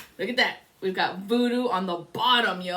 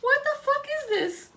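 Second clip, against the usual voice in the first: 1 shocked high squeak. Retold or imitated. Dream shock, voice still high.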